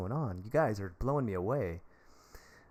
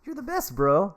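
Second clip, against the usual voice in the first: much higher, gushing dude voice; mock excited streamer; 11 semitones higher, 5 dB louder than usual. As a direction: imitate yourself gushing over a tip. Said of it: "every time you stop the conversation to do the".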